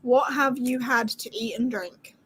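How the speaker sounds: ordinary talk, nothing marked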